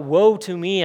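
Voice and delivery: loud, retold outcry; crying out. Preacher quoting Isaiah before the Lord, crying out loudly. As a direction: cry it out, preacher quoting a prophet. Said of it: "Isaiah cries out".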